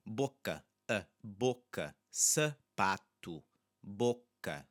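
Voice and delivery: slow, careful Portuguese model words; Portuguese demo words. Shown as a demonstration. Teacher modelling Portuguese words for students, slow and careful. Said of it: "that's the unstressed sound".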